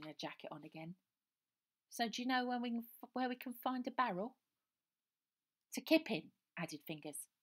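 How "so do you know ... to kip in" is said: put-on child voice. A narrator's reading. Children ask for barrel.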